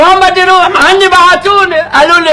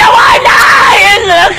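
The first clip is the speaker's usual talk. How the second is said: mock lament; 6 semitones higher, much slower than usual.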